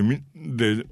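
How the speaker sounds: normal talking voice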